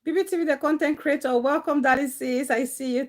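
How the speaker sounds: normal talking voice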